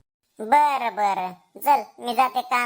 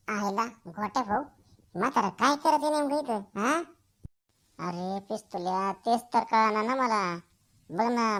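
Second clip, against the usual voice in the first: hushed spying talk; 2 semitones lower, 5 dB quieter than usual. Acted. Two friends hiding and spying, hushed whispering voices. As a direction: keep it down so the old man can't hear.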